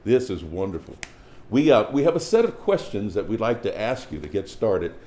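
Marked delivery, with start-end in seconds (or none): none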